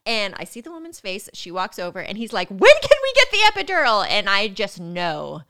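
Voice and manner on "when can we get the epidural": loud, high, rushed shout; frantic demand. Retold or imitated. Partner shouting at the nurse for the epidural, frantic demand. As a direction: burst out as a panicking partner.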